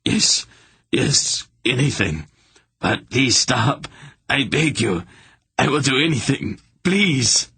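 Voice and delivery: high breathless pleas, climbing to a cry on please; terrified begging. A narrator's reading. Hierarch begging Garion to stop hurting him, terrified plea. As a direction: grovel for mercy.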